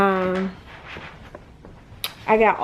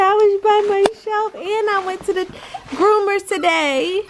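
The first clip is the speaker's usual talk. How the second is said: voicing the dog; 10 semitones higher than usual. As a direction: speak for the dog, high and pouty. Voicing the dog's thoughts.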